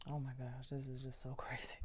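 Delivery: breathless whisper with a small laugh; overwhelmed and whispering through a laugh. Real, not acted. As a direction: whisper it, half laughing in disbelief.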